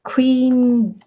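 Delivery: ordinary talk, nothing marked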